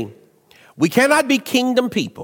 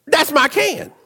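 high, rising sass; mock indignant claim; 8 semitones higher than usual. Pastor playing himself confronting the lady, high mock indignation. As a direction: stake your claim with comic sass.

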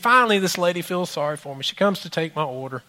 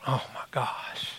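whispered realization; 5 semitones lower, 12 dB quieter, slower than usual. Retold or imitated.

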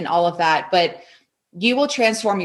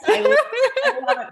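delighted laughing; faster than usual. Real, not acted.

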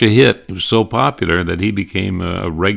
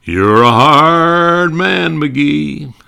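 slow, much higher, loud pompous line; Gildersleeve catchphrase; 9 semitones higher, 7 dB louder, much slower than usual. Retold or imitated. Gildersleeve teasing McGee, slow and pompous. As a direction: stretch it out like a pompous blowhard. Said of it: "the line he used to use on Fibber McGee".

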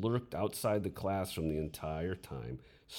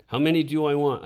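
much louder, higher demanding question; harsh demand; 10 semitones higher, 13 dB louder, faster than usual. A narrator's reading. Young teacher copying his friend's punishment, harsh loud demand. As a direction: drive the question at the student in anger. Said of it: "repeated Dickie's lesson".

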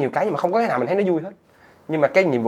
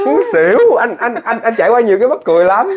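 excited laughter; 9 semitones higher, 10 dB louder than usual. Real, not acted. Laughing disbelief recounting story.

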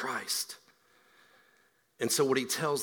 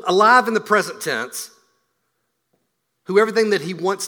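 louder, higher preaching drive; full-force preaching; 6 semitones higher, 8 dB louder than usual. Acted. Preacher to congregation, driving the point home loudly. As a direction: preach it with conviction.

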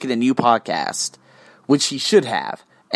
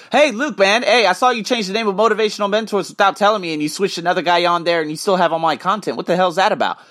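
mock confrontation; 7 semitones higher than usual. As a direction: rush through it like confronting Luke. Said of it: "let's have a mock-up of a conversation".

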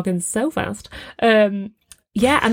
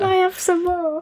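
high-pitched laughing orphan plea; Oliver; 10 semitones higher than usual. Acted. Co-host playing the orphan asking for food, high and pleading. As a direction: beg in a small high voice through laughter. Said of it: "Oliver when he asked for some more".

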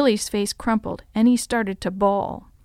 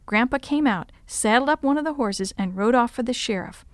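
usual speaking voice

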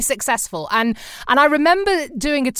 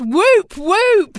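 loud, higher celebratory whoops; cheering whoop; 7 semitones higher, 4 dB louder than usual. Real, not acted. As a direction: cheer her on with two whoops. Cheering the role model list.

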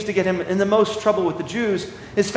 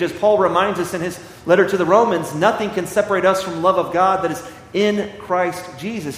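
normal talking voice

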